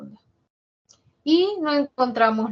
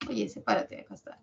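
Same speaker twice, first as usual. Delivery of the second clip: whisper; 6 dB quieter, faster than usual. Pretend. Talking to letters.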